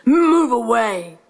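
slow, drawn-out lion roar; big lion voice, stretched words. Acted. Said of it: "roared the lion".

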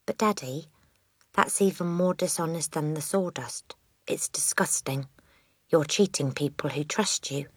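put-on soft, earnest child's reproach; disapproving little girl. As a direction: quietly scold your dishonest father.

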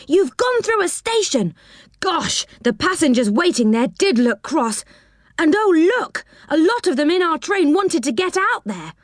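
high, urgent worried voice; alarmed. A narrator's reading. Rick telling Joe he missed the station, alarmed. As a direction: panicky telling-off.

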